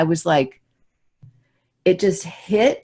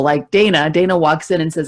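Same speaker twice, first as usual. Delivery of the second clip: ordinary delivery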